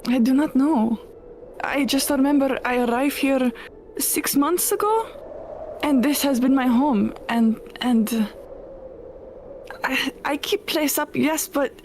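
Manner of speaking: clipped foreign-ish witch, unsure; lonely witch defending her tower; witch character. Acted. Player voices the witch.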